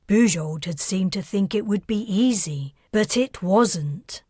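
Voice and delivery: plain reading voice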